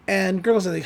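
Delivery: normal talking voice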